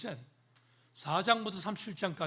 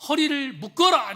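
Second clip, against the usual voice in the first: booming commanding voice of God; God sternly ordering Job; voicing God; 6 semitones higher, 6 dB louder, slower than usual. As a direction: command it from on high, louder and higher. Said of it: "이렇게 말합니다".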